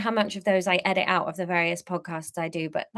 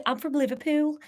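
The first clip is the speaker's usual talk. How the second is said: higher-pitched, hammed-up Liverpool accent; bad Scouse accent; 5 semitones higher than usual. Pub landlord inviting guests to eat, laughing through bad Scouse. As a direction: attempt Scouse and crack up laughing. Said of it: "so he's gonna be Scouse".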